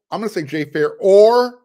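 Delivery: or hit loud and high, left hanging; loud, lifted or that leaves the choice open; stress on or. Shown as a demonstration. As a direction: punch the word or and let it hang. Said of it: "You hear me emphasizing the word or".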